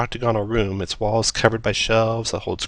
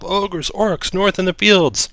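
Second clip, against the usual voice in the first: high, frantic cry for help; panicked villager scream; 9 semitones higher, slower than usual. Acted. Villager begging the adventurers for help, panicked high cry. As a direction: shriek the warning in a panic. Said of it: "screams for help".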